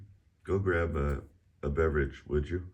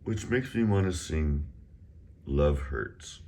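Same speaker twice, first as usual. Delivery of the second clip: normal talking voice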